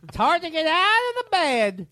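put-on high, slow trembling old man voice. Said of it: "one of your funny voices".

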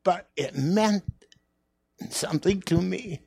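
real emotion breaking through; choked up. Real, not acted. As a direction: voice rising and slowing with emotion. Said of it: "I always get too emotional".

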